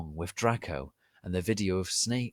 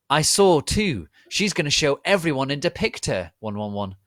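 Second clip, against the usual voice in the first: loud, high furious outburst; angry shout; 7 semitones higher, 10 dB louder than usual. Acted. Harry defending Ebony from Draco, loud angry shout. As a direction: shout it out in outrage. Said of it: "Harry shouted angrily".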